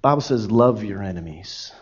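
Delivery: tenderly remind, almost hushed; gentle correction. Retold or imitated. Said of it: "God's Word will come to you and say".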